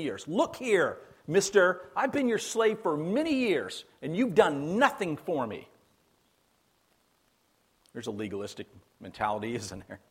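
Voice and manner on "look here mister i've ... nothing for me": higher, resentful complaint at the father; hammed-up sulky grievance; whiny older brother talking back to dad; mock indignant son. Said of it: "Even the way he addresses his father".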